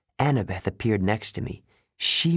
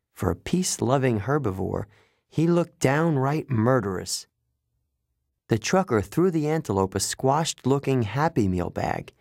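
plain reading voice

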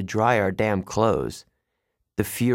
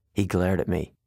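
ordinary delivery

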